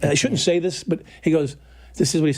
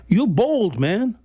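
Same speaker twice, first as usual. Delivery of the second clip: higher, louder imitation of a challenger; confronting inmate; 6 semitones higher, 4 dB louder, much slower than usual. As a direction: call him out, punching the key word. Acting out the big inmate's challenge.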